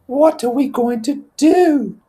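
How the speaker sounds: put-on high, slow, worried boy; Piggy's anxious voice. Piggy asking Ralph what to do, high and slow. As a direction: ask it like a fretful schoolboy.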